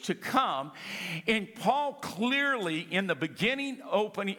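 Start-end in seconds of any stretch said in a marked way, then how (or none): none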